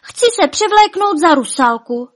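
high, loud, eager outburst; excited cry. A narrator's reading. Špuntík tells Svatava his costume idea, excited cry. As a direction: blurt out the plan excitedly. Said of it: "vykřikl".

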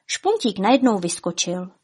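plain reading voice

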